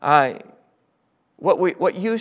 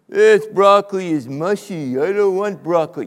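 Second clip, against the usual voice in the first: put-on whiny child; 2 semitones higher, 7 dB louder than usual.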